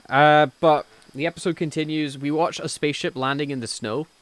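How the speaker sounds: usual speaking voice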